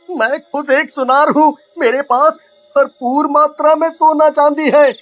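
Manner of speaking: stammering, panicked pleading; frightened begging. Acted. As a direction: beg for your life. Man begs serpent for mercy. (गिड़गिड़ाते हुए)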